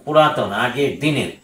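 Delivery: usual speaking voice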